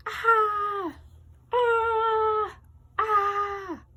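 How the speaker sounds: high, loud shrieking Ahs; screaming child. Acted. Taylor screaming at her ruined blanket, high loud shrieks. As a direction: shriek like a furious little girl. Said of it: "She started to yell and scream".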